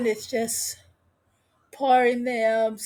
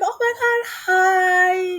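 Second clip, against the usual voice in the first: high-pitched excited squeal of hello; mock-gushing hello, pitched way up; gushing greeting; 8 semitones higher, 4 dB louder than usual. Acted gushing greeting between two women.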